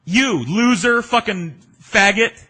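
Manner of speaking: high, loud mock yell at a stranger; yelled insult. Anna Nicole insults him from street.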